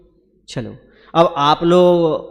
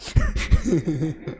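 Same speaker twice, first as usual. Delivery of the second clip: light chuckle, higher than the speaking voice; amused chuckle; 5 semitones higher, 4 dB quieter than usual. Real, not acted. Guru teases committee men about scolding, amused chuckle. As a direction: chuckle at your own teasing.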